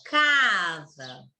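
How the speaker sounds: "Casa" with CA drawn right out; long, emphatic CA in "Casa"; longer stressed syllable. Shown as a demonstration. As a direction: stretch the open first vowel of "Casa", short falling sa. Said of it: "um tempo maior".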